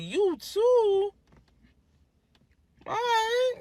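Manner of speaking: high surprised squeak; mock surprise. Second guy says me too, high surprised reply. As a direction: act caught off guard.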